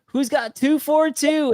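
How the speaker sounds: high, drawn-out celebratory call; mock yell, each number stretched out; crowd yelling. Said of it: "everybody was, like, yelling".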